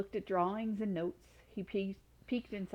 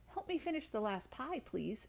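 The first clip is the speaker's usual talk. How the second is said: pleading; 5 semitones higher, 3 dB quieter than usual. A narrator's reading. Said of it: "she pleaded".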